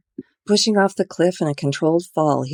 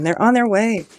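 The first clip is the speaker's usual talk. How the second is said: high, loud worried shout; 8 semitones higher, 5 dB louder than usual. Acted. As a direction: call down to someone below. Shouting down to injured man.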